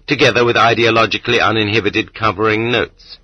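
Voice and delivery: usual speaking voice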